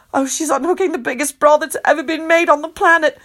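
usual speaking voice

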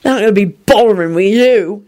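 put-on drunken, spiteful sneer; nasty, slurred. A narrator's reading. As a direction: slur it nastily, dragging the words. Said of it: "he added nastily".

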